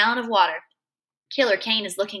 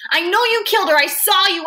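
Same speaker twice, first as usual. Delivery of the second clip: high, loud accusing cry; screaming; 8 semitones higher, 6 dB louder than usual. A narrator's reading. Boy who can only keep screaming at his father, screaming. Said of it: "all I can do is keep screaming".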